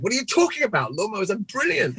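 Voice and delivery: high mock-offended lawnmower fan; hammed-up indignant museum owner; indignant enthusiast. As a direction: puff up in outraged defence of lawnmowers. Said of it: "And the guy's like".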